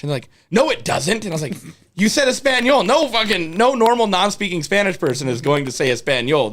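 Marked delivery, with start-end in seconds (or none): 0.5-1.2 high, protesting comeback; retold denial. Acted argument over language. 1.9-6.5 high, loud accusing protest; indignant mock argument. Acted argument over language.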